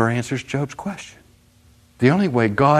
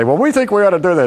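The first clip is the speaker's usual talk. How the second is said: cocky greenhorn; 8 semitones higher, 5 dB louder, much faster than usual. Retold or imitated. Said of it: "they'd say".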